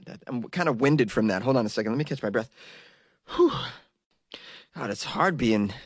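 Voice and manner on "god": ordinary delivery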